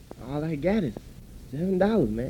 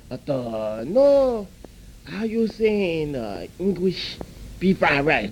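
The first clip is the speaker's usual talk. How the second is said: a broad, put-on Chinese accent from the restaurant man; mock Chinese accent; slower than usual. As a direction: play the chop suey house owner with a mock accent. Owner again, same put-on voice.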